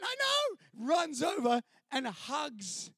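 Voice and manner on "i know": very high shouted I know; a pitched-up mock yell. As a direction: yell agreement at the guys. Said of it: "I'm going".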